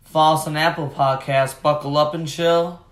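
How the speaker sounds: loud, upbeat show-host intro; hyped podcast intro. Acted. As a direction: pump up the listeners.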